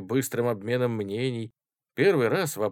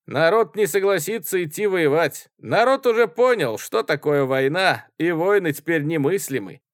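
loud, emphatic certainty, raised pitch; peremptory; 8 semitones higher, 8 dB louder than usual. A narrator's reading. Student settling the war question, peremptory. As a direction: declare it beyond any dispute. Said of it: "безапелляционно сказал студент в тужурке".

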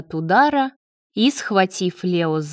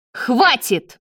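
higher-pitched, contained yell; 4 semitones higher than usual. A narrator's reading. David wants the nightmare over, yelling. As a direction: cry out to end it. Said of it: "заорал он во всё горло".

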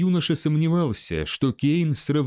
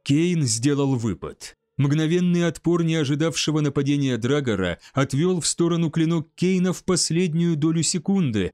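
plain reading voice

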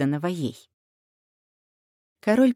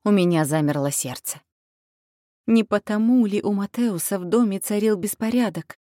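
ordinary delivery

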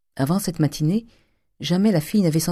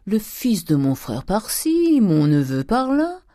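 mocking repeat; 6 semitones higher, slower than usual. A narrator's reading. Mocking his daughter's words.

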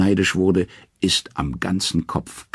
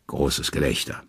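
ordinary delivery

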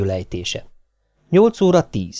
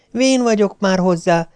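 unconvincing; 7 semitones higher than usual. A narrator's reading. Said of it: "olyan hangon, amely senkit sem győzött meg".

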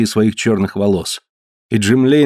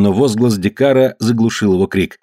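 ordinary delivery

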